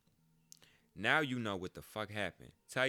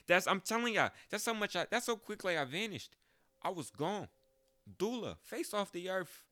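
excited; 11 semitones higher, 4 dB louder than usual. Real, not acted. Excited emphatic telling.

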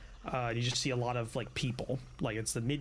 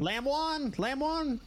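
bartender calling out; 12 semitones higher, much slower than usual. Acted. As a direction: sing out the order like a busy barkeep. Bartender calls out dish.

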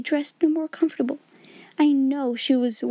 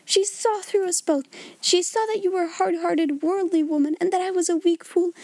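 higher-pitched, impassioned accusation; hysterical outburst; 6 semitones higher than usual. A narrator's reading. Miss Amelia tells off her sister, hysterical and high. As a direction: pour it out in a high, agitated voice. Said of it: "had made her hysterical".

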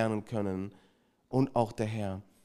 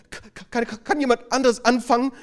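mock panic; 9 semitones higher, 5 dB louder than usual. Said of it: "kriegt richtig Angst und Panik".

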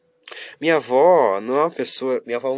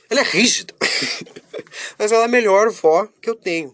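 laughing; 11 semitones higher, 6 dB louder than usual. Real, not acted. Laughing about strict grandma.

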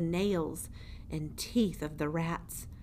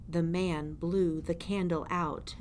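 ordinary delivery

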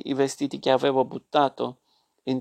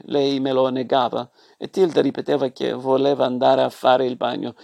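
plain reading voice

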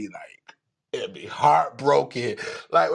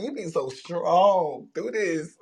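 real tears pushing through the words, slowly; crying; 4 semitones higher, much slower than usual. Real, not acted. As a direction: voice breaking into a tearful cry. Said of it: "I was just crying 'cause".

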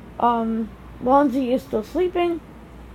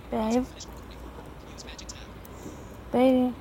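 quiet, coaxing pet names; softly calling a sleeping husband; soft wake-up calls; 10 dB quieter than usual. Real, not acted. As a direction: coax a sleeping husband awake gently. Gently waking sleeping husband.

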